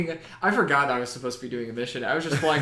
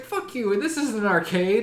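mock game taunt; 8 semitones higher, 5 dB louder than usual. Voicing game taunting player.

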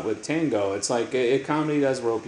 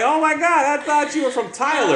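mock surprise; 6 dB louder than usual. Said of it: "I'm like".

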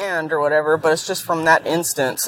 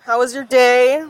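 sweet cooing voice for a cat; talking to a cat; 6 semitones higher than usual. Pretend. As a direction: coo at the listener like a pet cat. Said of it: "I'm talking to you like you're my cat".

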